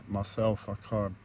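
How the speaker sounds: ordinary delivery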